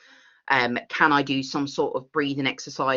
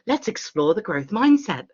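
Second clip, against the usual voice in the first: higher, put-on chirpy pitch; mock enthusiasm; 6 semitones higher than usual. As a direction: imitate an overeager invitation to learn. Mimics an upbeat invitation to learn.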